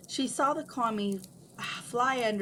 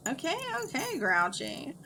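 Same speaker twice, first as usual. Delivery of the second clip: much higher, exasperated gripe; griping; 7 semitones higher than usual. A narrator's reading. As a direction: give in grudgingly. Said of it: "Clyde griped".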